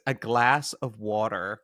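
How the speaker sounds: put-on slow, drawn-out Californian accent. Three-year-old mimics his Californian aunt, slow Californian accent. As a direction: stretch it like a Californian, slowly. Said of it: "does an impression of my sister-in-law".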